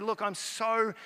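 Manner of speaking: drawn-out, emphatic mock desperation; exasperated retold plea. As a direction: throw up your hands vocally, retelling. Retells his overwhelmed plea to couple.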